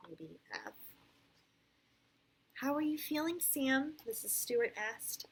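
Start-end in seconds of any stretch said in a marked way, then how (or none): 2.5-5.2 clear, animated read-aloud; storybook voice with lively rises and falls; picture-book narration. A narrator's reading. Parent reads Sam's story to a child, animated storytelling.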